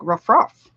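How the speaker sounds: playful woof woof; dog bark. Pretend. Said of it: "Like a dog".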